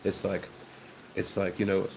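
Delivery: ordinary delivery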